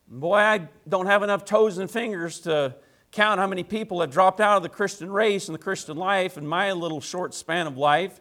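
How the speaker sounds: normal talking voice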